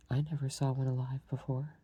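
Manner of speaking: ordinary delivery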